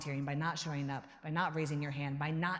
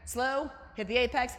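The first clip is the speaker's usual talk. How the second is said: normal talking voice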